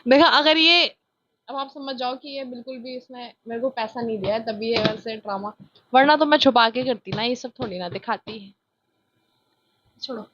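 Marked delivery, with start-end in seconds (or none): none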